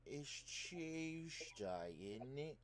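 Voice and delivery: slow, high put-on British accent. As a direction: drawl it like a toff Brit. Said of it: "I'm doing a British accent".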